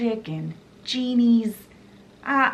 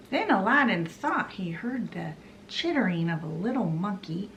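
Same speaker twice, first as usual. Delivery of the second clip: ordinary talk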